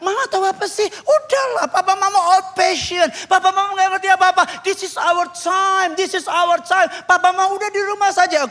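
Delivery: very high, mocking youngster voice; insolent Gen Z. Gen Z child dismissing old-fashioned parents, insolent. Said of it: "Gen Z zaman sekarang kalau sama papa mamanya".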